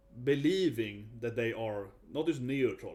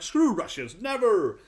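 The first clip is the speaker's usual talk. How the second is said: 1 mock angry outburst; 8 semitones higher, 8 dB louder, slower than usual. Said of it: "and he was like".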